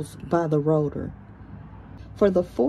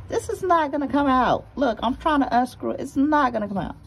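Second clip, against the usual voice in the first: frustrated; 7 semitones higher, 4 dB louder than usual. Real, not acted. Stuck sensor, complaining.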